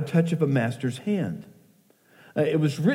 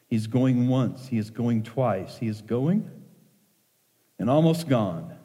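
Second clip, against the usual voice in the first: poem recitation. Acted.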